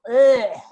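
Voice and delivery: loud disgusted groan, retold; a big fed-up ugh at an idea. To the director, a loud disgusted groan. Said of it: "I just go".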